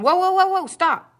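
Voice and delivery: high, loud alarmed whoa; panicked instructor. Retold or imitated. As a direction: yelp it out like a startled driving teacher. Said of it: "she panicked".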